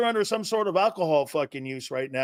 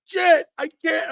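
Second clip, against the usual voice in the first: much higher, louder mock shout of an overexcited fan; mocking excited fan; 11 semitones higher, 5 dB louder than usual. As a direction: ham up a gushing fan who can't contain himself. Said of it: "You're going".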